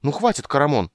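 loud exasperated outburst; fed-up snap at the drunk; snapping. Acted. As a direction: lose patience and snap. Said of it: "сорвался Кендер".

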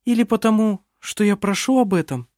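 a hint of a young woman, gently. A narrator's reading. Vinga voiced higher.

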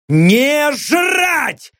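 high sharp bark of a shout; barked refusal. Acted. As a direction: snap the order back hard. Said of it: "снова рявкнул Лёха". (резкий рявкающий крик)

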